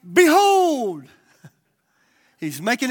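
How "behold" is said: loud, high proclamation; booming Behold; proclaiming. Acted. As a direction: ring it out like a herald. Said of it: "Paul's on the scene and he's like".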